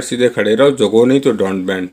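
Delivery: ordinary talk, nothing marked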